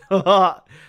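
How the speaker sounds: short, high, loud laugh; real laughter. Real, not acted. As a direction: let out a loud burst of laughter. Laughing at a chat comment.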